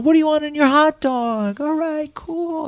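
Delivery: high, light mock wife voice; wife's voice. Retelling the movie to a co-host, a high mock wife voice. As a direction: pipe up like the wife at the grill.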